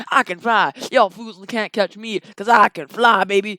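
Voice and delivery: loud, crowing taunt; Donkey. Acted. Donkey taunts his chasers from the air, loudly boastful. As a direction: boast loudly, punching out the words. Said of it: "as Donkey was flying in the air".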